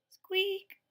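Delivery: a high, loud mouse squeak; piercing high squeak. Acted.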